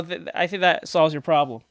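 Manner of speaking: normal talking voice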